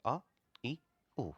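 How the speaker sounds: "अ", "इ", "उ" as brief single beats; short basic vowels. Shown as a demonstration. Teacher showing the basic sounds, short vowels. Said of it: "एक basic ये आवाज़ है".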